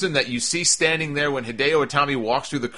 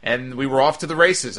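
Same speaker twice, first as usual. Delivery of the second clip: ordinary talk, nothing marked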